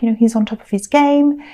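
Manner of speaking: usual speaking voice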